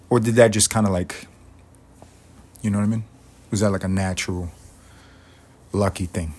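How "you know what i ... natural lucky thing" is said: ordinary delivery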